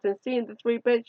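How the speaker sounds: usual speaking voice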